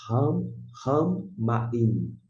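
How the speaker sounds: ram-ramaim drawn out with a doubled M, very slow; mock stutter on the M; how not to say ramaim; wrong doubled pronunciation. Shown as a demonstration. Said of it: "Você não vai falar".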